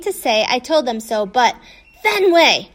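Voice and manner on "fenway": louder, pretend-mad growly call; hammed-up growly scream of the name; mock angry scream. A narrator's reading. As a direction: fake fury, playing at being mad. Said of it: "Hattie screams even louder, pretending to be mad and growly".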